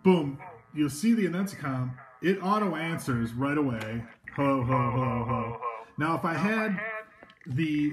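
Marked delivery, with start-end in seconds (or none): none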